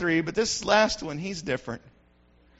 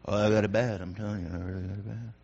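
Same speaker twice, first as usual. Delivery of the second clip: much lower, soft moaning complainer fishing for pity; self-pitying complainer; 9 dB quieter, faster than usual. Retold or imitated. Pastor mocking a churchgoer who wants sympathy, in a low pitiful moan. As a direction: groan out your troubles for sympathy. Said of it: "talk bad ... musters up more sympathy".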